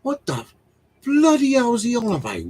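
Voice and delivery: high-pitched, exasperated confusion; acted bafflement. Husband baffled by a friend's technical message, mock exasperation. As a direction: leap up high on bloody hell, hammed-up. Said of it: "and I'm going".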